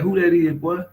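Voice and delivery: high, loud curious call; teasing high-voiced shout; hammed-up high question; mock homie. Homies calling out.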